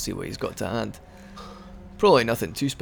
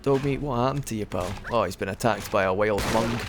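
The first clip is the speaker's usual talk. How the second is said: usual speaking voice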